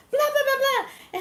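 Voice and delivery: high, loud mock babble; imitated clamor. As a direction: mimic a whole group jabbering at once. Imitates everyone talking at once.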